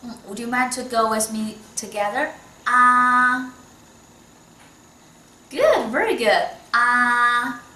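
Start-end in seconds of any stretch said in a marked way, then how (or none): 2.7-3.5 Ah held loud on a high, unmoving pitch; high level first tone. Shown as a demonstration. Teacher leading the student in first tone, high level Ah. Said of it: "so that's very high". 6.7-7.6 Ah long and loud, staying high; high level first tone. Shown as a demonstration. Teacher repeating after praising the student, high level Ah. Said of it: "so that's very high".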